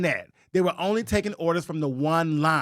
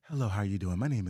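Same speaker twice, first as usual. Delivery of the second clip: polite phone voice; 10 semitones lower, 7 dB quieter, much faster than usual. Retold or imitated. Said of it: "I code switched so good".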